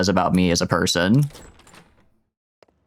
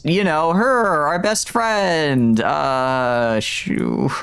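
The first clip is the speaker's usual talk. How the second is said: slow, drawn-out stalling; 5 semitones higher, much slower than usual. Real, not acted. Stalling, can't recall name.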